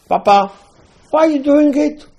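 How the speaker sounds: high, loud mocking child; the wicked son taunting his father in a high voice; wicked son's voice. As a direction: squeak it out like a cheeky boy.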